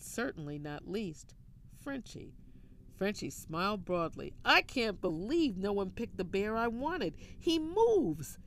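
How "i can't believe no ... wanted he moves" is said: higher and louder, bubbling over with delight; gleeful gushing that peaks loud and high at the end. A narrator's reading. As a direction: gush about it, thrilled and rising. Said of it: "she gussed".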